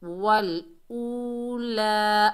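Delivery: slow tajweed chant of वल ऊला; recited slowly. Shown as a demonstration. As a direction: draw out ऊला by one alif. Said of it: "एक अलिफ की मिकदार खींच कर पढ़ेंगे".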